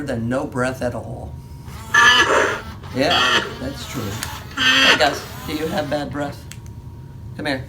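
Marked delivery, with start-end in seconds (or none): none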